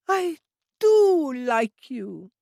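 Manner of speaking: squeezing her friend's arm with delight; high, loud, drawn-out childlike affection; affectionate Trudy. A narrator's reading.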